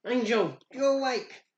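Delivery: high, thrilled exclamation; excited in-character squeal; excited relief. Acted. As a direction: cry out in delight that a friend woke. Player voices pony greeting woken friend.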